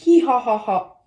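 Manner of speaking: loud triumphant laugh; loud gleeful laughter; loud giggle. Real, not acted. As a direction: laugh out in glee. Laughs after cheering.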